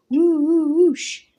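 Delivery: high, loud howling woosh; wind noise. Acted. Commander conjuring a wind in the lodge, howling. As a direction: blow like a gust of wind.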